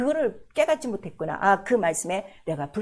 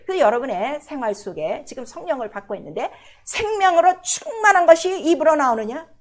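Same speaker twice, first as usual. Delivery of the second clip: ordinary delivery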